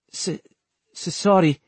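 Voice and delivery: stammering. Acted. Vincent apologizes to the soldier with a mild nervous stammer. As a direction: trip over the first sounds of sorry. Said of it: "stammered Vincent".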